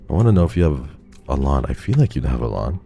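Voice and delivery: ordinary talk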